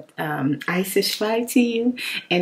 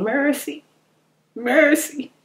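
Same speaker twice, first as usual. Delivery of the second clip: higher, pleading "Mercy, mercy"; crying stomach; 6 semitones higher, slower than usual. Retold or imitated. Voicing her growling stomach begging, high and pleading. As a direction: beg like a starving stomach. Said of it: "It be hollering".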